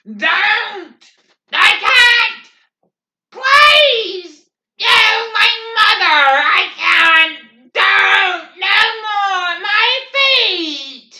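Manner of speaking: very high, loud sobbing shrieks; hysterical screaming. A narrator's reading. Weeping redhead at the half-track, shrieking hysterically. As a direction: scream and sob in panic.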